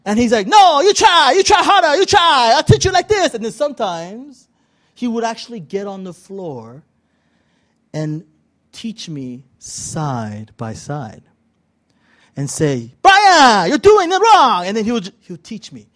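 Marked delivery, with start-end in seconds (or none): 0.5-3.3 loud, high mock-accent exhortation; insistent Brazilian master. Master urges student on the floor, insistent accented yell. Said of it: "he had this thick Brazilian accent". 13.0-14.7 high, loud mock coach yell; Brazilian master correcting. Master teaches student side by side, accented scolding yell. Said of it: "he had this thick Brazilian accent".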